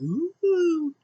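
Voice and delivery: high, held "ooh"; imitated "ooh". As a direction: coo a long high note. Imitated sound as briefcase is opened.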